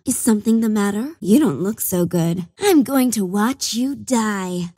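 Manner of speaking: ordinary talk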